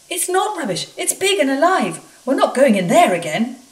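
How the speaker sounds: high, loud, panicky protest; frightened workmen. Acted. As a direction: insist in terror, voice up high. Frightened workmen refuse the tunnel.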